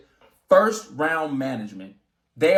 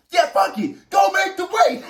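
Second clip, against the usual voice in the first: loud, much higher yell breaking into a laugh; mock-exasperated shout; 14 semitones higher, 4 dB louder than usual. Boxer mocking his own caving in, loud high laughing shout. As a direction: throw up your hands and shout it, laughing at yourself. My dumb ass.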